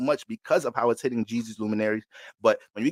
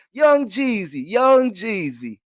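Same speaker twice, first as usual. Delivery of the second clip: high, slow, drawn-out calling of the name; old Georgia lady cooing; 7 semitones higher, 6 dB louder, much slower than usual. Retold or imitated. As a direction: croon the name like a proud old Southern neighbor. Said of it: "that's my Georgia old lady".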